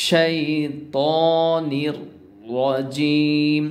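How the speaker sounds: the র in শাইতানির said thick and heavy; heavy r sound. Shown as a demonstration. Teacher showing the thick র, slow and drawn out. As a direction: roll a full, heavy র in শাইতানির রাজিম. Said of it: "এখানে রটাকে মোটা করতে হবে".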